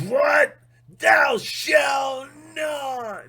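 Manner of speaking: high, loud, very slow mock voice of God; mock booming God. God scolding the confessing sinner, mock booming shout. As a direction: thunder the commandment like an outraged deity.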